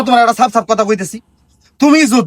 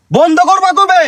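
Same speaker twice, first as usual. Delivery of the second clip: high-pitched outburst; raised, exasperated voice; exasperated yell; 9 semitones higher, 3 dB louder, slower than usual. Acted. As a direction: yell the demand as patience snaps. Son repeats demand, shouting.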